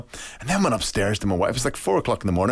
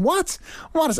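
妻子半夜惊讶地问：什么？；sharp high startled question; startled what. Retold or imitated. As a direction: jump up high in disbelief. Wife startled at 4 a.m.

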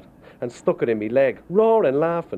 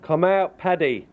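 retold mock yell; 7 semitones higher, slower than usual. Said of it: "they were screaming".

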